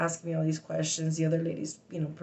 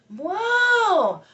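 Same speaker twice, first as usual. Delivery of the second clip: high loud yelp; sudden involuntary shout; loud tic yelp; 8 dB louder than usual. Real, not acted. Explaining outside, a loud high tic yelp. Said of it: "to somebody who has Tourette's".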